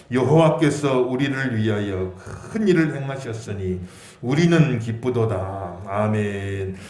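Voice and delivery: slow, low, measured Bible recitation; scripture reading. Acted. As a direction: read it out slowly for all to follow. Leads congregation in scripture reading. (낮고 느린 성경 봉독)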